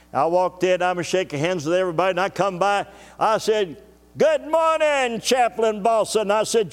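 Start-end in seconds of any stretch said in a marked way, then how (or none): 4.2-6.3 high, slow, bright hello; cheery greeting. Retold or imitated. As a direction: sing out the good morning.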